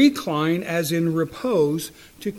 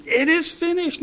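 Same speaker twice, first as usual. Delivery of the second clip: Jesus's cry; 11 semitones higher than usual. Acted. Asking listeners to hear Jesus on the cross, high cry. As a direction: cry it out high, nothing mousy. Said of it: "Not a quiet, weak, mousy".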